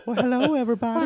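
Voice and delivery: high cartoon sitcom voice; doing a Family Guy character. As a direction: hit a cartoon-dad pitch way up. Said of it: "the Family Guy".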